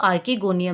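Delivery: archegonium said as "ar-ki-GO-nium"; hard K pronunciation. Retold or imitated. Teacher quoting other teachers' way of saying archegonium, with a K sound. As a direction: use a K for the "ch" in archegonium. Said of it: "कुछ teachers को सुना है".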